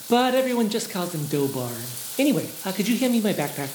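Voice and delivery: lightly voiced, easygoing companion; casual Aziza voice. Aziza voiced behind the door.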